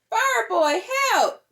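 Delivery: high, urgent call for help; a child shouting for rescue; cry for help. Acted. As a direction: call out to Fireboy in a panic. Character calls for help.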